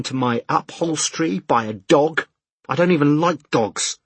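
exasperated rant. A narrator's reading. Man at breaking point to exam official, exasperated rant. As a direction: let pent-up pressure boil over, word by word. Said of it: "a new pressure building inside him".

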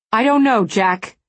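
loud, raw cry of anguish; anguished outburst. A narrator's reading. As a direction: burst out in pain.

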